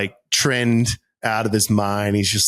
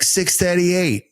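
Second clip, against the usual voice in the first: slow, higher, broad Boston numbers; Boston accent; 7 semitones higher, slower than usual. Retold or imitated. Answering the driver, slipping into Boston accent. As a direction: drop the r's like a Bostonian. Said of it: "having a Boston moment".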